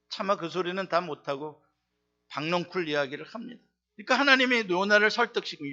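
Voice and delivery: normal talking voice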